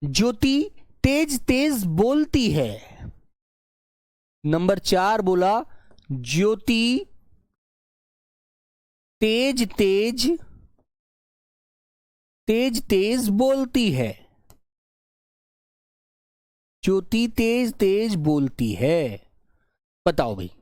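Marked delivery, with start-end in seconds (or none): 0.0-3.0 slowed example sentence, a little higher; teacher spelling out an example slowly; slow dictation. Shown as a demonstration. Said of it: "मैंने बोला". 9.2-19.3 teacher slowly stressing the adverb तेज तेज; very slow dictation. Shown as a demonstration. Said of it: "ये तेज तेज जो है ना".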